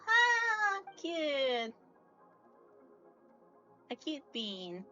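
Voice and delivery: normal talking voice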